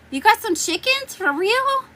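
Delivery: high, startled disbelief; surprised. Real, not acted. Hearing scratching, asks the viewer in shock, high and startled. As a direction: pitch jumps up in disbelief.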